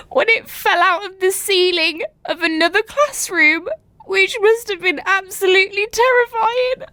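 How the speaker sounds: high-pitched, laughing in horror; horrified laughter. Real, not acted. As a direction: squeal it out through helpless laughter. Reacting in laughing horror to story.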